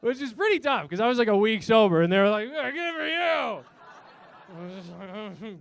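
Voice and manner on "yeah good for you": high, drawn-out mock cheer; mock party cheer. Drinking friends congratulating the newly sober guy, mock cheer. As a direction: stretch it out like a tipsy well-wisher.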